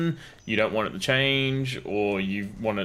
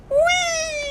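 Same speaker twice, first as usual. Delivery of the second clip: very high, drawn-out squeal of "whee"; gleeful whee; 4 dB louder than usual. Real, not acted. Player flung around by a friend, gleeful whee.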